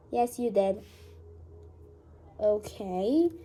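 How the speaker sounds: normal talking voice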